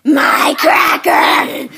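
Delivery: whiny shout. Acted. Doll protests cracker taken.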